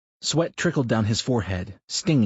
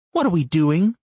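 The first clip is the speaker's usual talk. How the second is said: Brenda's voice; 9 semitones higher, 7 dB louder than usual. Acted. Wife teasing her husband mid-run, higher voiced. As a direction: narrator lifts into a higher, lightly voiced wife.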